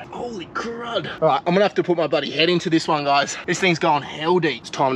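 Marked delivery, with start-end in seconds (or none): 0.1-1.2 slow, soft, high holy crud; a hushed, drawn-out exclamation; amazed. Real, not acted. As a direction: let the amazement stretch it out. Sees how deep the hole goes.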